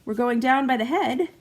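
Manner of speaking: high, loud alarmed cry; alarmed wake-up call. Retold or imitated. Anxiety waking her at night, high loud alarm. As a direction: jolt her awake in alarm. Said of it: "anxiety would be like".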